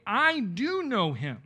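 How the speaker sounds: loud, slow, high stress on "I do"; slow, forceful declaration leaning on "I"; emphatic "I". Shown as a demonstration. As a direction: insist on it, punching "I do". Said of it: "he did so for emphasis".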